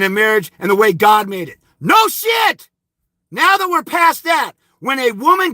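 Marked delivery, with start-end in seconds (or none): 1.8-5.5 furious shout. Real, not acted. Yelling at commenters about custody, high raging shout. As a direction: explode at him, then keep pushing.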